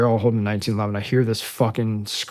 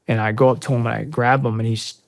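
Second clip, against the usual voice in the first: ordinary delivery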